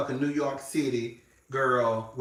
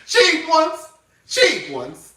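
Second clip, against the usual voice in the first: much higher, louder cry of it between laughs; laughing exclamation; 12 semitones higher, 9 dB louder, much slower than usual. Real, not acted. Telling viewers cheap coats shed, cracking up, high and loud. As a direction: blurt it out, cracking up.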